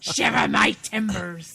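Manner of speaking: pirate phrase through a laugh; giggling mock pirate; laughing pirate line.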